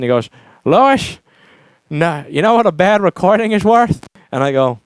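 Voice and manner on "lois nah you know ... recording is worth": put-on Texas accent. Elderly studio man schooling a young engineer, toothless Texas accent. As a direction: imitate a toothless Texan in his eighties. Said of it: "with this Texas accent".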